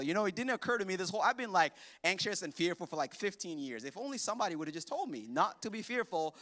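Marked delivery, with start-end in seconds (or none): none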